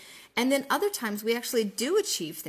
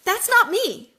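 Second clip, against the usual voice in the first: much higher and louder, punching "not"; emphatic realization; 10 semitones higher, 9 dB louder, slower than usual. Real, not acted. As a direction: declare it with sudden conviction. Sudden self-realization.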